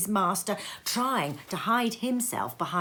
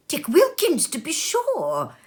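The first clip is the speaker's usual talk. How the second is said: excited recognition; 9 semitones higher, 4 dB louder than usual. A narrator's reading. Scrooge recognising old friend.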